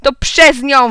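loud, high accusing yell. A narrator's reading. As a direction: blame her at full voice. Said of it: "wrzasnął Anselm". (głośny, oskarżający wrzask)

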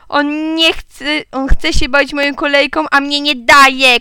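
put-on loud, high child's complaint; shouted complaint. A narrator's reading. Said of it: "krzyknęła Otylia". (głośna, piskliwa skarga)

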